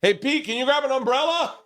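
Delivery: real high-pitched holler to a helper; calling out. Real, not acted. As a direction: shout over to someone for a favor. Calling to Pete off mic.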